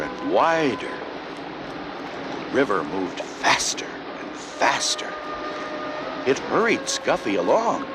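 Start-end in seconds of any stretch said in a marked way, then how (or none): none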